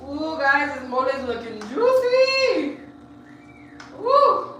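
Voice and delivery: high-pitched cooing over food; delighted. Real, not acted. Streamer showing viewers a juicy mole, delighted. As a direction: coo high, ending on a squealed ooh.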